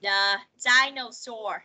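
The dinosaur drawn out slowly; teacher modelling the phrase slowly; slow model reading. Shown as a demonstration. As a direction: say The dinosaur slowly for pupils to copy. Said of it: "đọc lại theo cô".

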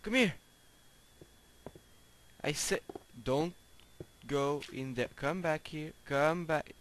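high loud calling to a pig. Real, not acted. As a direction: shout for the pig to come.

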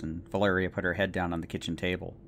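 ordinary delivery, nothing marked

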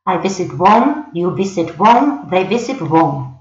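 slow, careful teacher read of the English; slow classroom model sentences; slow English demo. Shown as a demonstration. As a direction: model each word slowly for learners. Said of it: "se dice siempre igual".

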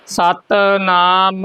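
ਸਤਿਨਾਮ slowly as "satt-naam", firm t; tight, drawn-out reading. Shown as a demonstration. Teacher leading learners in repetition, tight slow recitation. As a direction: press the t in ਸਤਿਨਾਮ, stretch the rest. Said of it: "ਇਹਨੂੰ ਕੱਸ ਕੇ ਬੋਲਣਾ ਹੈ".

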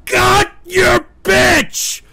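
high, loud, stretched-out retort; outraged shout. Real, not acted. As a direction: throw the insult back, dragging each word. Host snaps back at insult.

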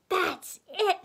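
high, loud outburst; screaming. A narrator's reading. Miss Piggy snapping at Kermit, screaming. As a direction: burst out in a storybook scream. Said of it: "screamed Miss Piggy".

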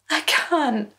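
put-on high small boy's voice on the edge of tears; tearful boy. A narrator's reading. Humiliated son begging his mother, high and tearful. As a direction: plead like a frightened child.

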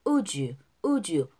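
"would you" run together as "woo-joo"; linked pronunciation. Shown as a demonstration. Teacher demonstrates linking, "would you" as "woo-joo". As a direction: link "would you" into one j sound. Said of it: "would도 역시 연음돼서".

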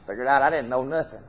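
ordinary delivery

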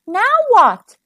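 high-pitched, drawn into a query; puzzled storybook child; anxious question. A narrator's reading. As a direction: ask it in mild dismay. Friends stuck, Bertie asks in dismay.

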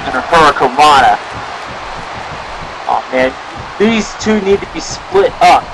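live ringside play-by-play, rising to shouts; wrestling commentary. Pretend. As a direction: call the match like a wrestling announcer.

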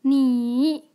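drawn-out 你 that sinks and rises; third tone. Shown as a demonstration. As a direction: dip 你 down, then lift it. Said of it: "third tone".

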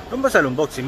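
normal talking voice

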